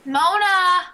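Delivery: loud high call. Acted. Depression calling Mona across the beach, loud.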